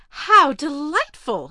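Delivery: high, loud and slow gushing delight; a spoiled girl thrilled by a new toy. Acted. Spoiled heiress hears of the dog.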